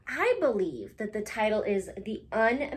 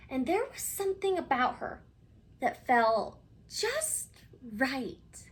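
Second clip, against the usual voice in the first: storybook reading; 2 semitones higher than usual. A narrator's reading. Teacher reading a picture book to her class, gently. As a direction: read it like bedtime to little ones.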